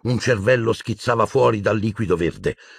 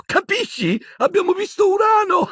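very high-pitched giddy voice breaking into laughter; giggling. A narrator's reading. Dazed Ron repeats his Uranus joke to Harry, giggling. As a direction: crack up at your own silly joke. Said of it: "sempre ridacchiando".

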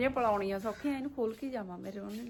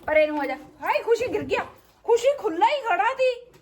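loud alarmed high cry; dramatic loud aunty; 8 semitones higher, 6 dB louder than usual. Real, not acted. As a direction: shout in sudden alarm. Calf falls, loud alarm.

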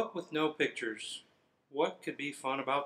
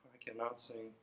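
quiet; 4 semitones lower, 15 dB quieter than usual. Real, not acted. Reader dreads singing.

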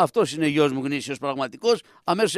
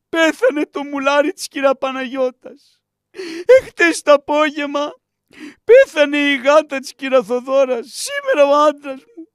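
high put-on woman's voice keening the dead, slow at the start; hammed-up widow's lament, crying out the losses; mock lament; 8 semitones higher than usual. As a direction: told to cry, she wails a mock dirge for her husband. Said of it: "Κλαίγε, είπαμε να κλαις".

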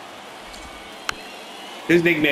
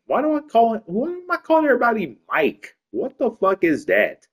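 baffled at himself; 12 semitones higher than usual. Real, not acted. Baffled by his own name slip.

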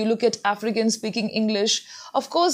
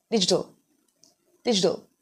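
American pronunciation; 3 semitones lower, faster than usual. Shown as a demonstration. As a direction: say "digital" the American way, t almost a d. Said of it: "when it comes to American pronunciation".

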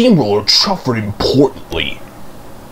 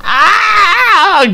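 high, loud anguished cry; pained groaning cry; 5 dB louder than usual. Acted. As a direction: cry out in stuck, painful distress. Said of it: "groaned Daisy".

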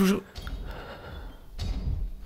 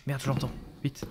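very soft hushed words; whispering while hiding; 5 semitones lower, 17 dB quieter than usual. Real, not acted. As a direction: barely voice it, hiding. Hears the monster nearby.

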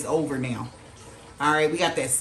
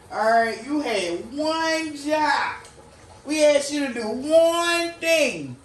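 higher, very slow, exasperated scolding; 7 semitones higher, slower than usual. Retold or imitated. Universe scolding the one who failed, higher exasperated drawl. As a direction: lay each word down like a weary boss. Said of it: "Kinda energy".

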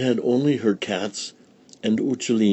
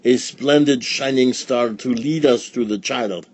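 put-on wise old sage; 4 semitones higher, 4 dB louder than usual. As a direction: proclaim it with reverence. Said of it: "cried the wise man".

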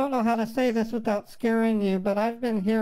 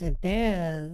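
low, drawn-out ominous answer; Captain Howdy; 6 semitones lower than usual. Retold or imitated. Woman retells the voice's answer, ominous. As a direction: drop low and stretch it out. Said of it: "And he goes".

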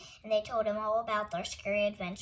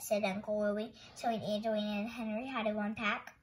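plain reading voice

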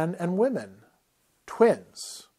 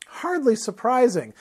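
high, lazily drawled remark; 9 semitones higher than usual. A narrator's reading. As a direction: draw it out, unimpressed. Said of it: "the woman drawled".

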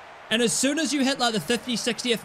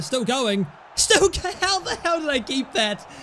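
ordinary delivery

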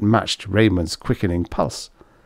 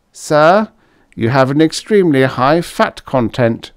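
primly voiced android, raised pitch; prim robot butler; 6 semitones higher, 4 dB louder than usual. A narrator's reading. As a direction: coolly correct mechanical servant. Android speaks to Raymond.

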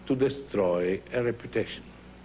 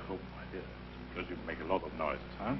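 much softer, quick frightened murmur; 7 semitones lower, 13 dB quieter, much faster than usual. Shown as a demonstration. Teacher voices frightened Bartolo in a fast, soft murmur. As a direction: shrink away and murmur fast. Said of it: "the poor man at the end will say".

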